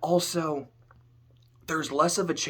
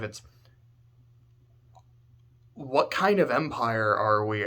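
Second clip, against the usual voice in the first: ordinary delivery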